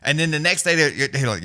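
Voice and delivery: ordinary talk, nothing marked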